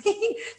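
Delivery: high, light giggle; short giggle. Real, not acted. Speaker after acting out her plea to her husband, short high giggle. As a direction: giggle at your own story. Laughs at own wifely plea.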